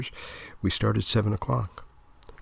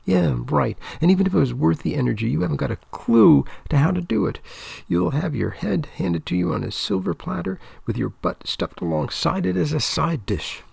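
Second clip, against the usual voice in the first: scornful; 7 semitones higher than usual. Acted. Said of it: "unnecessarily loud".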